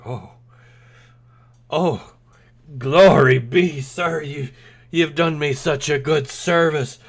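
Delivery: loud, high grateful cry; overjoyed beggar. Acted. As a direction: gush with thanks like a beggar given silver. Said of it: "and he goes".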